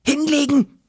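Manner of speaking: Hinlegen zischen. A narrator's reading. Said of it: "zischte sie".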